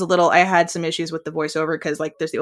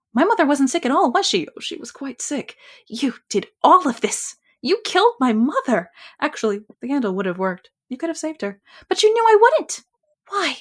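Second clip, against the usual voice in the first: higher, accusing, rising to a cry; anguished accusing voice; 8 semitones higher than usual. Acted. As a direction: confront the killer of her mother. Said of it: "bad impression voiceover".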